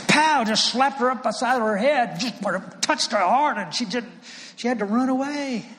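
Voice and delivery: loud, high-pitched, punchy retelling; explosive outburst. Retold or imitated. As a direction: burst out like a sudden blow landing. Acts out the sudden eruption.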